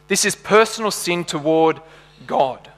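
ordinary delivery

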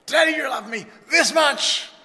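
put-on high-pitched shouted kid's question, building to "this much"; excited child. Child asking daddy about love, excited shout. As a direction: shout it like a kid stretching for the biggest amount. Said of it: "the kids come like this".

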